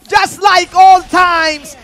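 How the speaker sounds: loud, slow drawn-out shout; hype shout. Real, not acted. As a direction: stretch it out like a big caster call. Caster hypes a pick.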